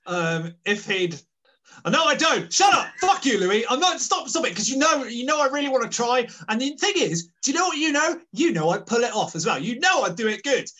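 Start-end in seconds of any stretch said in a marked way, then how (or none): 1.9-10.7 loud, high, flustered refusal; mock-outraged protest. Real, not acted. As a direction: protest hotly, sorely tempted to give in. Goaded by co-host into risky impression.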